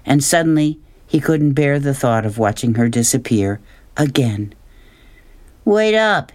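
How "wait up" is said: higher mock shout after her; a boy calling out, pitched up. A narrator's reading. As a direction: call to her as she walks off. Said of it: "he shouted".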